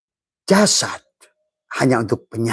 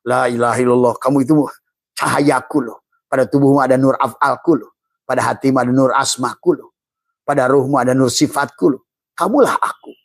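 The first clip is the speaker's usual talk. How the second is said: louder, rising preacher's cry; preaching at full force; 3 semitones higher, 4 dB louder than usual. Acted. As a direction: proclaim it as the voice of God. Preaching God's voice to the soul.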